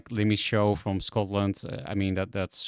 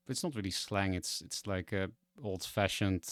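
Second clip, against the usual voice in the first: ordinary talk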